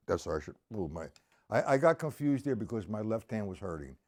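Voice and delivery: normal talking voice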